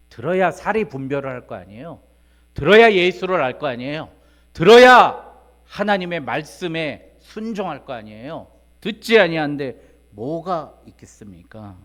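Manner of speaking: loud, insistent rhetorical questions, rising in pitch; full-force preaching. Real, not acted. Preacher urging the congregation to listen, loud and insistent. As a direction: hammer each question at the congregation.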